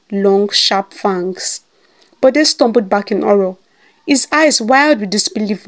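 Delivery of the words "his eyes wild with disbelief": ordinary delivery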